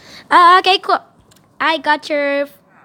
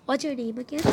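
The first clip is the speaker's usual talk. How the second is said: ordinary delivery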